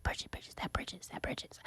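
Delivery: hushed whisper of gossiping inmates. Retold or imitated. Inmates across the cell whispering her name, hushed whisper. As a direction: breathe her name under your breath. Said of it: "all I hear is voices".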